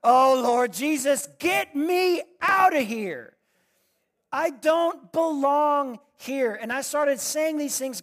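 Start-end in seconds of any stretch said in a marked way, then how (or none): 0.0-6.5 high, loud, slowed wail of despair; anguished pleading. Retold or imitated. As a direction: beg the Lord to get you out, stretched and strained. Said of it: "the anguish on my face".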